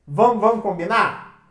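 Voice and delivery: usual speaking voice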